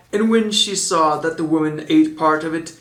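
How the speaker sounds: ordinary delivery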